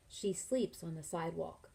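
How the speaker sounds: ordinary delivery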